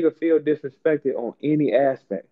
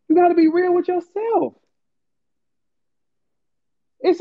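worked-up rant; 9 semitones higher than usual. Real, not acted. Heated lecture to single women.

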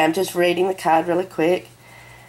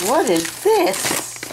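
curious surprise; 7 semitones higher, much slower than usual. Real, not acted. Picks up unknown item.

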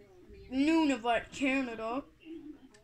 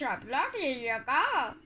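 ordinary talk, nothing marked

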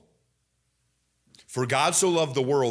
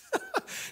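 short high laugh; 9 dB quieter than usual. Real, not acted. Laughs at scripture's irony.

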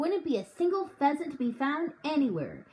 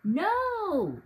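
high, startled outcry; shocked cry; 8 semitones higher than usual. A narrator's reading. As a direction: gasp it out in alarm. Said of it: "I cried".

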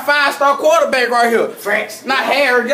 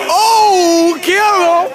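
cheering shout; 6 semitones higher, much slower than usual. Real, not acted. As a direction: yell it out to the team. Cheering players at the game.

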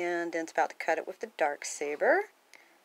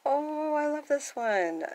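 high, slow, drawn-out coo; high-pitched loving sigh of delight; adoring gush; 9 semitones higher, slower than usual. Real, not acted. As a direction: gush slowly over a favorite. Gushes over a favorite page.

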